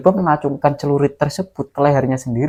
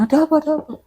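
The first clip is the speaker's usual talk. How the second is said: alarmed question; 12 semitones higher, much faster than usual. Retold or imitated. Villagers arrive asking anxiously.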